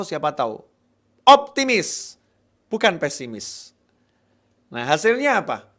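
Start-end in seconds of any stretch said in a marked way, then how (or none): 1.2-3.7 "Optimis" shouted high and loud; ringing exhortation. Acted. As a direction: fling the word out to rouse the crowd. Preacher exhorts the congregation.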